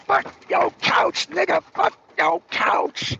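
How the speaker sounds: lightly voiced cocky rock star, dragged out slow. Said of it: "He's like".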